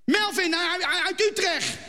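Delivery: put-on shy boy in broad plat Utrechts; nervous Utrecht boy with a laugh; broad Utrecht accent. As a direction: stammer it out in a thick Utrecht accent. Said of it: "dat kunnen we horen".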